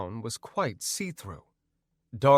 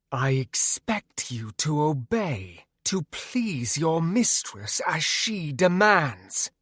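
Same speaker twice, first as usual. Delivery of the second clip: commanding demoness; 4 semitones higher, slower than usual. Acted. Demoness commanding her captive.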